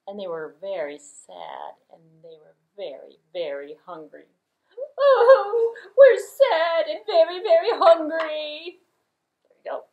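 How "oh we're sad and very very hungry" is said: high, loud whimpering goat cry; sad goat voice. Acted. Goats moaning to the audience about hunger, high crying goat. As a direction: wail like a starving little goat.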